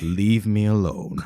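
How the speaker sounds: slow, put-on voice of a disgruntled author; grumpy writer. Comics writer wanting no part of it, slow grumpy voice. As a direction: draw it out as a reclusive writer.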